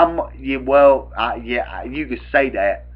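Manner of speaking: ordinary talk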